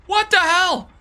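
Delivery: high, startled what the hell; real disbelief, voice jumping up; sudden shocked outburst. Real, not acted.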